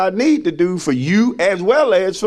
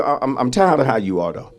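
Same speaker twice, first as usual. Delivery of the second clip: normal talking voice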